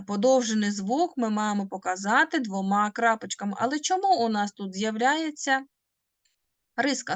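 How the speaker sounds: ordinary talk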